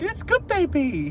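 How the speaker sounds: very high, drawn-out cooing to a dog; imitating own sing-song pet talk; high-pitched dog-talk voice. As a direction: coo to a dog in a squeaky-high baby-talk voice. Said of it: "talk to a dog in a high-pitched voice".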